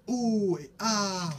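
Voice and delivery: amazed ooh and ah. A narrator's reading. Charlot thrilled by the bonsai, high ooh and ah. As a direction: coo in delight. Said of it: "Charlot fait".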